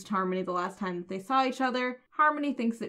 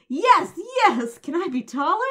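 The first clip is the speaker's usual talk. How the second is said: mock-eager, higher and louder; eager yes; 7 semitones higher, 7 dB louder than usual. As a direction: play Jonathan jumping at the offer. Jonathan eager in retold quote.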